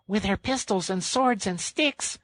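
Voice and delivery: very high rat voice, no real volume; high-pitched mock shout; excited shout. A narrator's reading. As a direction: pipe it up like a small animal rallying. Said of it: "shouted the Rat".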